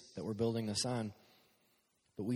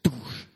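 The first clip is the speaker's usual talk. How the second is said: a booming "doosh" sound; mock subwoofer boom; 7 dB louder than usual. Shown as a demonstration. Youth pastor mimicking his mic booming in the subs. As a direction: imitate the bass thudding in the subs. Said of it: "I have this deep, manly voice".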